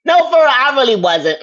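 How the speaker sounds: high, loud protest; loud insistence. Real, not acted. Insisting loudly to the harasser.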